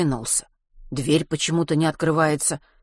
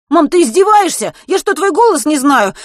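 very high, loud incredulous protest; outraged yelling; 12 semitones higher, 10 dB louder than usual. A narrator's reading. Boy to mother who denies being his, outraged. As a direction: shout in disbelief down the phone.

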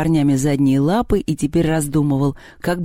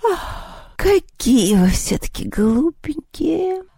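high, slow words through a yawn; yawning cat; 11 semitones higher, much slower than usual. A narrator's reading. Vaska pities the silly children, yawning. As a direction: stretch it out breathily while yawning. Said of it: "зевнул Васька".

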